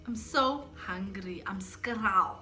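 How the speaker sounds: slow Durban slang; Durban accent. Shown as a demonstration. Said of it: "My sister will do Durban".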